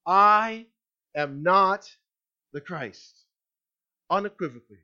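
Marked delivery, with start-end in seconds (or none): 0.0-3.2 "I", "am not", "the Christ" in separate, deliberate pieces; emphatic declaration. Acted. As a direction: declare "I am not" firmly, then let "the Christ" fall away. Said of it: "he emphatically declared".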